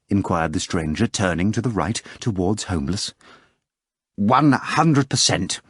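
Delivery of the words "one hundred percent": emphatic affirmation. Acted. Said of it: "affirmed the poet".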